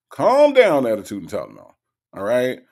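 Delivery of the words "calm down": high, loud teasing Calm down; mock exasperated cry. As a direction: shout Calm down up high, playfully. Repeats calm down, much higher.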